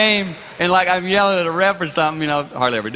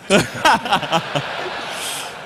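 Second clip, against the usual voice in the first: voiceless chuckling burst; laughing; 3 semitones higher than usual. Real, not acted. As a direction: laugh at your own joke. Man laughs after his own joke.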